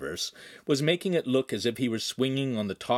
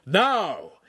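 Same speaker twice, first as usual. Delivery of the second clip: loud, high one-word order; angry scream; 8 semitones higher, 5 dB louder than usual. A narrator's reading. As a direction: demand obedience with a raised shout. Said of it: "the master screamed".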